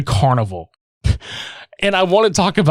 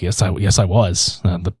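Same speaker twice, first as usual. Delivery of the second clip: much lower, halting mock politeness; awkward insistence; 10 semitones lower than usual. The hero insists to the carny, low and stumbling. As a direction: stammer it out stiffly in a low voice. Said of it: "He's just like".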